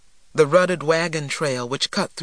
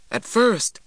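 ordinary delivery

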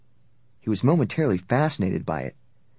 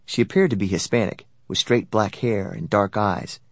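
plain reading voice

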